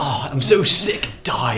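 slow, high, laughing mock death groan; overacted death scene. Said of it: "are you gonna make that voice when you die".

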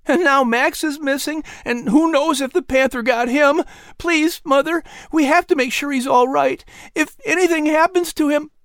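put-on high boyish voice, urgent and pleading; pleading injured boy. A narrator's reading. Son begging his mother to check on Max, pleading. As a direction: beg your mother to find the dog.

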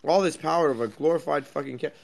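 usual speaking voice

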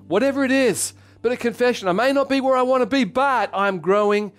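high, loud burst of conviction; impassioned preaching. Real, not acted. Preacher builds to emphatic confession.

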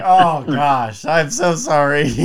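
high, loud, drawn-out comic regret; hammed-up apologetic whine; mock apology. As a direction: play at being terribly sorry. Acted apology to allergy sufferers.

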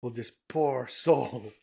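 put-on light French accent, slowed on the middle line; lightly done French voice; mock French accent. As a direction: toss it off as a quick French caricature. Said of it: "my micro impression of a French person".

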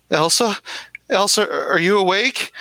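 put-on high, trembling young woman on the phone; Christina, scared. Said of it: "Christina's voice".

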